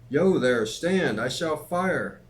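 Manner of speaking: loud, high shouted challenge; roared warning. A narrator's reading. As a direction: shout a threat to halt the rider. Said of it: "as loud as he could roar".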